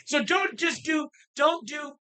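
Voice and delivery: high, loud and slow warning; emphatic shout. Real, not acted. Telling listeners the story's lesson, high loud slow shout. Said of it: "emphatic moral of story".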